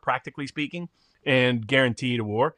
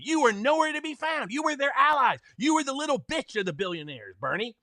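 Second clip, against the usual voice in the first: higher, heated scolding of Bernie; imagined angry rant; 7 semitones higher than usual. Pretend. Telling off Bernie Sanders in an imagined hearing, higher heated rant. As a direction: tear into Bernie, pitch climbing higher. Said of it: "I'd say".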